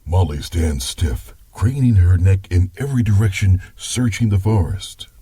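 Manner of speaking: story narration. Acted. Grandpa narrates.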